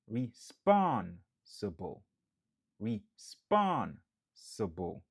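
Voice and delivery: Responsible slow with SPON hit hard; stress on second syllable. Shown as a demonstration. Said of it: "n ap aksantye sou dezyèm nan".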